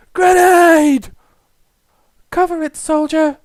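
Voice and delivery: high, loud alarm, then order; urgent military shout. Acted. Officer warning a soldier of a grenade, urgent shout.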